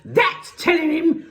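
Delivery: gleeful high, loud shriek; Missus Pratchett. A narrator's reading. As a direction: screech approval from the sidelines. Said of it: "shrieked Missus Pratchett".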